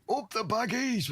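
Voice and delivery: high excited terrace cheer; mock football shout. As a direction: cheer it like a fan when his team scores. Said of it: "that's what he would shout".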